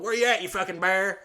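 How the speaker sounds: loud, high mock challenge; calling out. Husband runs around the yard after a bear, loud calling out. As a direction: holler for it across the yard.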